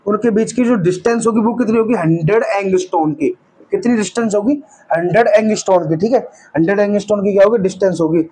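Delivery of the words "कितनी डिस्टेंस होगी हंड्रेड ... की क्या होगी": usual speaking voice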